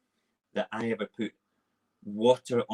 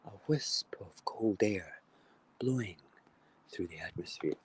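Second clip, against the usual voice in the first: hushed; 7 dB quieter than usual. Acted. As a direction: breathe it like a nature documentary. Hushed nature-watch description.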